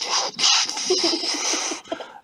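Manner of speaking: loud mouth-made whooshes and explosions; missile noises. Acted. Old lady fires her missiles, imitated whooshing. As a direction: imitate rockets firing with hissing blasts.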